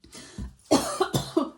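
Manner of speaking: harsh loud cough; coughing fit. Real, not acted. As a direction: cough hard and sudden. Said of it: "my coughing and spluttering".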